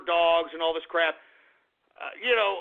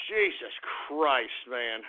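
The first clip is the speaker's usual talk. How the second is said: slow, much lower and softer groan of disbelief; weary disgusted sigh of words; exasperated aside; 8 semitones lower, 5 dB quieter, much slower than usual. Real, not acted. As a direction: mutter it under your breath, fed up. Exasperated aside mid-rant.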